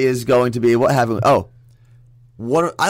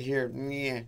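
limp, half-hearted mumble; 13 dB quieter than usual. Retold or imitated. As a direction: say it weakly, like a limp handshake. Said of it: "the limp hand".

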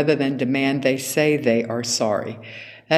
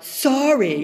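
mock sulky, higher sorry; child's eye-rolling fake apology, higher; grudging sorry; 10 semitones higher than usual. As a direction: toss off a sorry you don't mean. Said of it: "they say it like".

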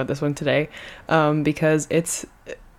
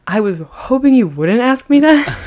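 sheepish; 8 semitones higher, 6 dB louder than usual. Real, not acted. Caught not knowing.

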